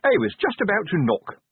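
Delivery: breeze in pleased with yourself; cheerful. A narrator's reading. Sergeant greeting his commander at the door, cheerfully. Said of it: "said Sergeant Colon cheerfully".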